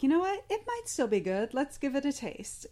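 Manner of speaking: bright hopeful quote; upbeat friend voice. Retold or imitated. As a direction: cheerfully suggest trying it. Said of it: "She was like".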